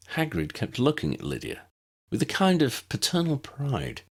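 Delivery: usual speaking voice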